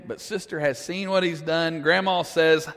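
ordinary talk, nothing marked

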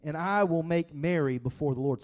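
loud, high preaching voice; preaching at full force. Acted. Pastor reading David's reply to the congregation, fervent preaching. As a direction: proclaim it to the whole room.

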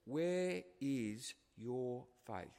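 slow, stinging rebuke from Jesus; stinging question. Retold or imitated. Jesus rebuking the frightened disciples, slow and stinging. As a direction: draw out the words as a stern rebuke. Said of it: "notice how he talks".

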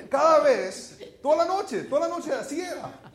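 amused exasperation. Real, not acted. Preacher recalling the endless bus ride, laughing exasperation. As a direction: relive that endless night with a laugh. Recalling nine hours of songs.

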